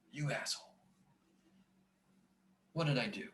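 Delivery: breathy whisper under his breath; whispered self-scolding. Real, not acted. Scene: teacher cursing his own mistake, in a whisper. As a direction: hiss it at yourself, barely voiced. Scolding himself over math error.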